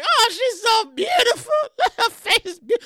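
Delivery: high, drawn-out cooing; mock gushing in a high voice; gushing mourner. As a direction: coo over the body like an admiring mourner. Voices mourners viewing the casket.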